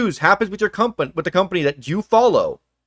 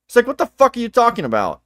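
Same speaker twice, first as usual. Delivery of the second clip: normal talking voice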